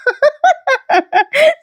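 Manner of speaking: long high laughing fit; helpless high laughter. Real, not acted. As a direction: lose control laughing. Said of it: "मला हसू control नाही होते". (अनावर हसू)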